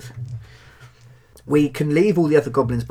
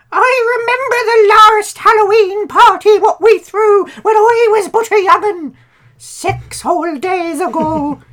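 harsh booming goblin elder; 8 dB louder than usual. Acted. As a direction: boom it out like a bitter ancient goblin. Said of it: "in his harsh, bitter, broken, deep booming voice saying".

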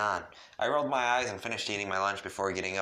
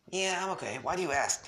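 beaming; 10 semitones higher than usual. Acted. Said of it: "He beamed".

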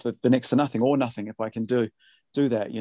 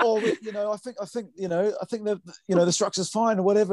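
put-on much higher London geezer accent; 9 semitones higher than usual. Producer imitating the band member's London geezer accent on the demos. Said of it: "gonna put on the same accent now".

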